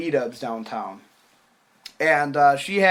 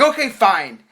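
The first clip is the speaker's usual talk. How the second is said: louder, higher fed-up surrender; exasperated; 5 semitones higher, 5 dB louder than usual. Retold or imitated. Him caving to her Facebook nagging, mock exasperation. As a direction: act out giving in, annoyed.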